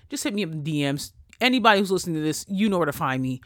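ordinary talk

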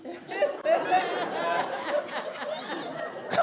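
real laugh jumping up high. Real, not acted. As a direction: burst out laughing at himself. Laughs at his worry.

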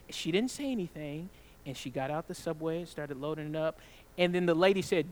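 ordinary delivery

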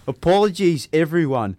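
shaky-footed Australian that could pass for Scottish; attempted Australian accent. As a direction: have a go at an Australian accent, loudly. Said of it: "do it in his accent".

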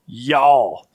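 y'all in a Southern drawl, one syllable; Southern plural you. Shown as a demonstration. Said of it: "How do we say plural you in the South?".

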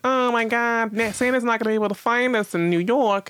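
put-on high, whiny little kid with a New York accent; little sister fretting about Santa, New York accent. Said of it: "she already has a New York accent".

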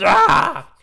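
loud, high mock shriek; imitated scream. Mimics reaction to thrown bird cage.

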